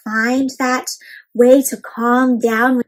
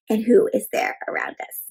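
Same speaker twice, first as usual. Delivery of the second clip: soft whisper; 2 semitones lower, 6 dB quieter than usual. Real, not acted. Teacher closing the story calmly, whispered. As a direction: drop into a hush.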